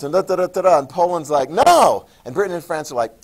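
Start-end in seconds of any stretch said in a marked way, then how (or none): 1.5-2.0 mock no. Poland refuses.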